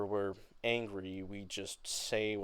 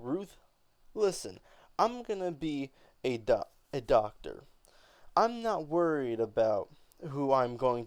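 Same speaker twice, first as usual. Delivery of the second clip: louder, higher, indignant; angry; 4 semitones higher, 7 dB louder, faster than usual. Acted. Beneatha snapping at Ruth, angry. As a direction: snap back at Ruth. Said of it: "begin to have an angrier tone".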